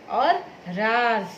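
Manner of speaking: राज drawn out slowly with ज़; nuqta ज demo. Shown as a demonstration. Teacher showing the nuqta form of राज, slowly. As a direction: stretch राज, ending on ज़. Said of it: "ज के नीचे यहाँ नुक्ता लगाया".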